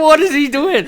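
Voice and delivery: high, loud, laughing mock amazement; laughing disbelief. Retelling his first sight of him, laughing disbelief. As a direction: act out amazement through laughter.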